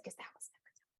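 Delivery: hushed, breathy confession under the breath; whispered aside. Real, not acted. Therapist adding a private aside about her own upbringing, whispered. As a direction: drop it to a whisper like a secret. Bitter aside after parenting advice.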